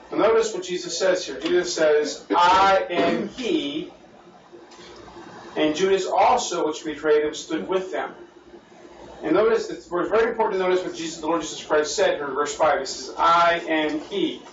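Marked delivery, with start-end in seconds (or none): 2.3-3.9 "I am he" spoken very slowly; slow solemn declaration. Retold or imitated. Quoting Jesus' answer solemnly. 13.2-14.4 "I am he" spoken very slowly; slow solemn declaration. Retold or imitated. Repeating Jesus' answer solemnly.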